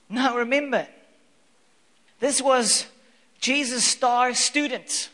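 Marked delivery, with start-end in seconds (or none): none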